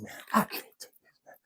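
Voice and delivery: hushed, breathy aside; whisper. Acted. Sneaking a remark down the stairs, hushed whisper. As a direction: whisper it like a secret.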